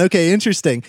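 ordinary talk, nothing marked

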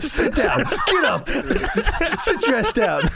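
mock Hogan bark. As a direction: bark the orders through laughter. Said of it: "Hogan says".

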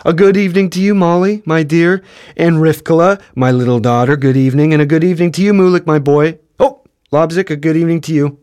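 effusive greeting. A narrator's reading. Father greets wife, children and dog in turn, effusively. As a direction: hand each greeting out with a lifted flourish. Beryl makes up for missed greetings.

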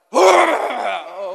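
loud, blustering "Oh"; mock outburst. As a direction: blurt it out like a know-it-all. Mocking opinionated person.

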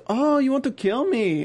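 hammy, unconvincing put-on accent; Carlito's accent. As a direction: attempt a villain's accent and botch it. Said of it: "I'm terrible at Carlito's accent".

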